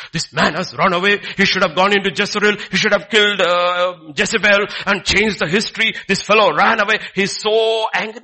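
hammed-up angry judge; imagined angry God. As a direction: rant as an angry God would. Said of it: "No, nothing. Whisper".

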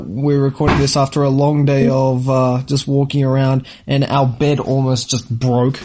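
ordinary talk

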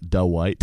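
an extra "uh" after the D in Dwight; two-syllable mispronunciation. Retold or imitated. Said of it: "I've heard people say".

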